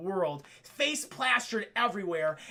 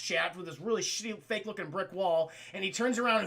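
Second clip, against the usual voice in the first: ordinary delivery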